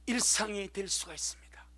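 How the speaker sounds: sudden hushed whisper. Real, not acted. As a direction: drop to a whisper. Preacher drops to a whisper.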